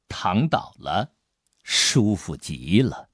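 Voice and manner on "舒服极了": ordinary delivery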